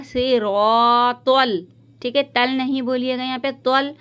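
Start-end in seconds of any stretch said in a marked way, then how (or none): 0.0-1.6 very slow with a full, rounded रॉ; heavy raw. Shown as a demonstration. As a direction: stretch out से रॉ तल with a heavy r. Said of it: "इसको रा नहीं बोलिएगा". (मोटे रॉ के साथ धीरे)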